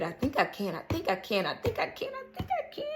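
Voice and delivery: soft, breathless little chant trailing off; quietly chug along, self-encouraging; hushed storybook engine chant; little engine that could. Acted. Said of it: "the little engine that could".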